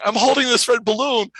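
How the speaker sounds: high, loud retold amazement; freaking out. Assistant holding the red balloon, loud and frantic. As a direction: act out overwhelmed disbelief at top pitch. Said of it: "I'm freaking out".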